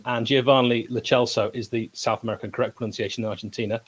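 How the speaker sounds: ordinary talk, nothing marked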